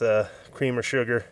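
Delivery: usual speaking voice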